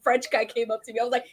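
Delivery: usual speaking voice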